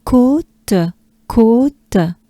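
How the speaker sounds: teacher models côte. Shown as a demonstration. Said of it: "It's a côte in French".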